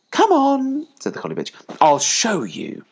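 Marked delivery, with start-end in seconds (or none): 0.1-0.9 high, loud call to the pups; mother dog calling. A narrator's reading. Collie calling her puppies to follow, high and loud. 1.8-2.8 slow, raised motherly voice; mother dog. A narrator's reading. Collie leading her puppies, slow raised voice.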